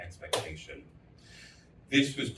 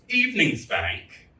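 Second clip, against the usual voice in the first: usual speaking voice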